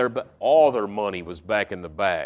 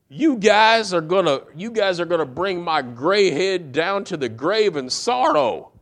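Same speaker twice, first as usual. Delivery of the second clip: higher wailing lament of a weary father; self-pitying old father; 6 semitones higher, 3 dB louder than usual. Acted. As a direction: moan it like everything's against you.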